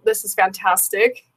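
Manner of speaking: ordinary delivery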